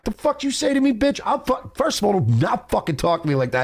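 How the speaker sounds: high loud furious yell at a guy; angry yell. Retold or imitated. Girlfriend yelling at a man who insulted her, furious. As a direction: scream it out, ready to fight. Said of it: "And they were like".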